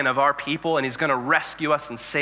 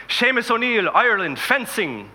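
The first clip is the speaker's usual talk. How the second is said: proud Irish announcing; 6 semitones higher than usual. Acted. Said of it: "and he says".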